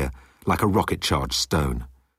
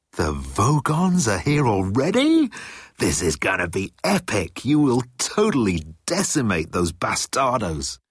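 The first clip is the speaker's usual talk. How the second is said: flamboyant character voice, stretched words; thrilled Zaphod; 3 semitones higher than usual. A narrator's reading. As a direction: gush with drawn-out, swooping excitement.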